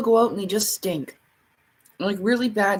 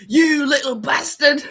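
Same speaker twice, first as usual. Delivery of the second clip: loud, slow Scottish growl, higher; Scottish guy impression; 5 semitones higher, 6 dB louder, slower than usual. Quoting the Scottish man in Uncharted, loud Scottish. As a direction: bark You little bastard like the Scottish guy. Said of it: "the really Scottish guy".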